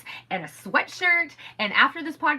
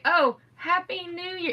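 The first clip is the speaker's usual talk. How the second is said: deflated mock letdown; 7 semitones higher, slower than usual. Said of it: "It was so awkward and sad".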